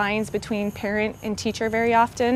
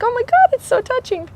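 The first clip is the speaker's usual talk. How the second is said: imitating her own moved gasp, much higher; mock-gushing, high and moved; touched gush; 14 semitones higher, 5 dB louder than usual. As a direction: retell how overwhelmed she felt, gushing. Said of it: "I was like".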